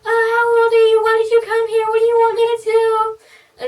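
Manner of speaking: piercingly high, loud stream of questions; overly cheery. Retold or imitated. Hairdresser quizzing her new client, overly cheery. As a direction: imitate a relentlessly perky hairdresser. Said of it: "she seemed overly cheery".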